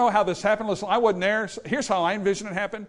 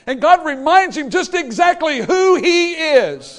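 preaching climax; 9 semitones higher, 9 dB louder, slower than usual. Real, not acted.

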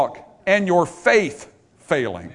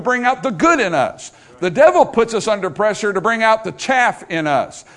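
raised, urgent pulpit delivery; forceful preaching; 4 semitones higher, 5 dB louder than usual. Real, not acted. Preacher warning his congregation about the devil, forceful preaching. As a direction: press the warning on the congregation, voice lifted.

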